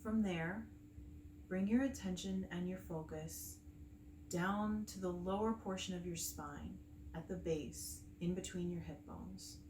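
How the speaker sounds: calm meditation guide with long pauses between phrases; unhurried, soothing breathwork instruction; guided meditation. Acted. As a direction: gently lead them through the breathing, leaving space after each phrase.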